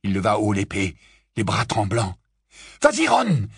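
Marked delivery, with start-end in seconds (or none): 2.8-3.5 high shouted encouragement; high urgent cry; urgent exclamation. Acted. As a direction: spur him on. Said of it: "s'exclama Harry".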